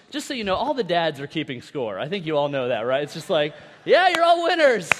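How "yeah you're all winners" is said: high, loud, over-cheery shout; mock cheering. Dad at kids' soccer who secretly keeps score, mock cheering. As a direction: cheer everyone with put-on enthusiasm.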